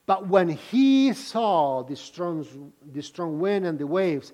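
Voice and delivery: ordinary talk, nothing marked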